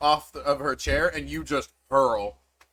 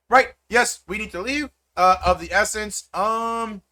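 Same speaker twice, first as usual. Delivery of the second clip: usual speaking voice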